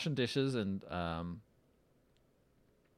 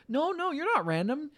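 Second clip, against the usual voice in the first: high-pitched, insistent child impression; reassuring little kid; 4 dB louder than usual. Imitating little sister reassuring her brother's girlfriend, high and insistent.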